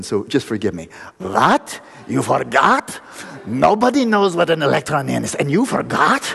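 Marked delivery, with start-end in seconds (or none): 1.2-6.2 a loud, incredulous German professor, pitched up; hammed-up German accent, mock outrage. German professor scoffs at the student, loud German accent. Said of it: "I have to do the German".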